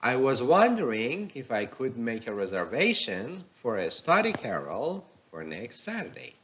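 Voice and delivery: "wondering", "reservation" and "carrel" swing up, "Saturday" falls; rising thought groups, final fall. Shown as a demonstration. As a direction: model reading with lifts on "wondering", "reservation", "carrel" and a drop on "Saturday". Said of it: "Let's read it again".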